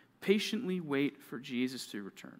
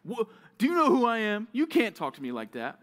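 loud outburst, starting high then dropping; mad retort; 6 semitones higher, 8 dB louder than usual. Acted. Person snapped at, answering with angry indignation. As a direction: snap back indignantly at full volume. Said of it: "That's our natural response".

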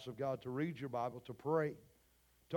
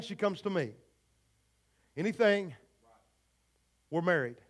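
ordinary talk, nothing marked

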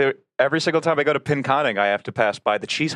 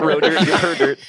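laughing mock accent; 4 semitones higher, 4 dB louder than usual. Echoing the silly accent.

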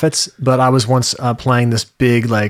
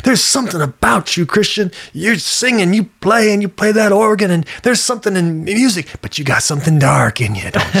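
high, knowing preacher voice; a hammed-up prophet sizing him up; prophet's voice; 6 semitones higher than usual. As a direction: play the prophet reading his soul.

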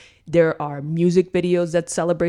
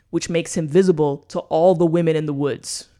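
normal talking voice

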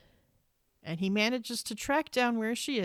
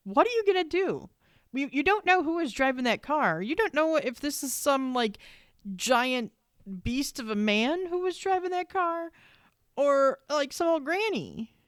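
pitched-up what-are-you-thinking rant at the TV character; incredulous outburst; 6 semitones higher than usual. Retold or imitated. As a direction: scold him like he's lost his mind. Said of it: "I'm like".

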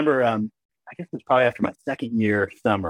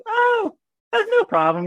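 much higher, relieved mom reassuring; cheery mom; slower than usual. Retold or imitated. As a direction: brush it off like a relieved mother. Mom voiced in story.

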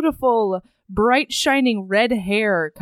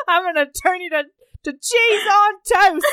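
mock Cockney accent; 9 semitones higher than usual. Showing off accents to a cohost, joke Cockney threat. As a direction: ham up a Cockney accent, stretching the vowels. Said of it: "I did accents".

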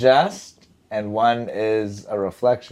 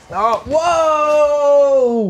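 high, loud startled whoa; real shock, shout it out; surprised shout; 6 dB louder than usual. Real, not acted. Camera guy grabs a bite.

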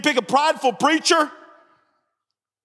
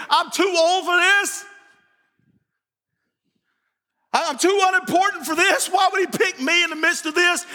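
exasperated protest; 7 semitones higher than usual. Retold or imitated. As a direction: cry out that it can't be you. Voicing Zacharias's loud protest.

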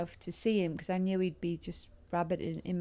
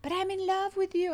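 much higher, hammed-up declaration of love; lovesick Italian; 12 semitones higher, 4 dB louder than usual. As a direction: gush it like the smitten date. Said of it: "There's nothing to talk about".

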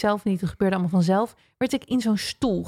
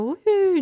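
high woohoo flying sound; whoosh cry; 11 semitones higher, 3 dB louder than usual. Retold or imitated. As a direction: sail away with a woohoo. Sent back to earth.

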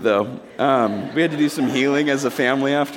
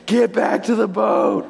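high, drawn-out mock shout; slow, high-pitched insistent call; urgent calling out; 9 semitones higher, slower than usual. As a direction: imitate disciples calling urgently. Disciples plead with Jesus, repeated louder.

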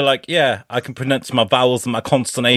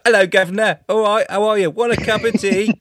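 put-on high-pitched "guv'nor" British accent; hammed-up British impression; mock British accent; 8 semitones higher than usual. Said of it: "That's so racist".

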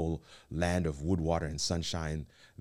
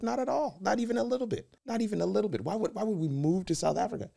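much higher, insistent no; emphatic refusal; faster than usual. Retold or imitated. Husband retelling his refusal to move abroad, high and insistent. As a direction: protest it can't be serious.

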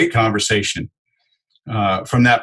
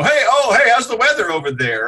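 high, chirpy fake-friendly salesman patter; pushy cold caller doing fake small talk; mock salesperson chatter; 10 semitones higher than usual. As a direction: ham up an empty sales greeting. Mocking bad sales small talk.

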